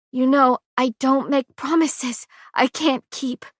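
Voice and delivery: a hint of a faint, dying voice; lightly voiced wounded girl; weak dying friend. A narrator's reading. Adina gently comforts Pei while dying. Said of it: "Her voice grows softer".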